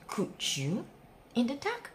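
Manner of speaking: plain reading voice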